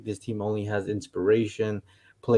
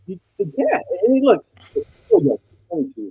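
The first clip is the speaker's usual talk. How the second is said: muffled, echoing bad mic; inside a submarine; 11 semitones higher, 4 dB louder than usual. Real, not acted. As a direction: voice like from underwater. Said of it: "You still sound like you're in a submarine".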